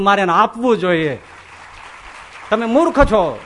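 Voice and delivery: Bali rebuking his guru. Retold or imitated. King Bali rebukes Shukracharya, loud and scornful. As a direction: scold the guru with fire. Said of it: "બલિ મહારાજ કે".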